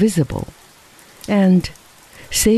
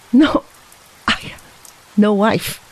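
choky laugh; 4 semitones higher than usual. A narrator's reading. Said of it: "with a choky little laugh".

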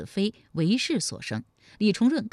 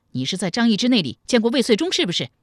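loud, fast, raised interrogation; angry bark; 4 semitones higher, 5 dB louder, faster than usual. Acted. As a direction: demand a confession sharply. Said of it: "怒视着新建喝道". (怒声喝问)

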